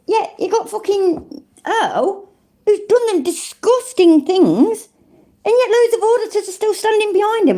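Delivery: very high-pitched, incredulous rant; indignant outburst. Real, not acted. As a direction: let outrage push the voice way up. Indignant rant about supported wrongdoers.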